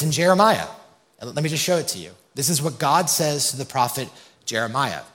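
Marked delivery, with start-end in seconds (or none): none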